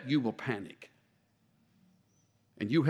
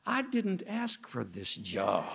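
soft, slow grumble to oneself; inner thought; 2 semitones lower, 8 dB quieter, slower than usual. Acted. Moses thinking about his complaining people, quiet aside. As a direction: think it aloud quietly, drawn out. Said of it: "what Moses is thinking right about now".

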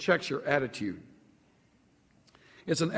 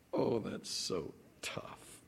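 unvoiced, much softer mock pity; mock sympathy whisper; 8 semitones lower, 14 dB quieter, slower than usual. Mock pity after a laugh.

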